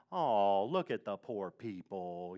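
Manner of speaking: low, soft, slow mock sympathy; detached pity. What Jesus did not say on seeing the crowd, mock pity. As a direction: murmur a token aww, drawn out. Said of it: "it's not like he sees a big crowd and goes".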